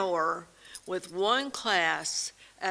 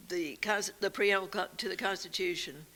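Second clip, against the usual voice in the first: ordinary delivery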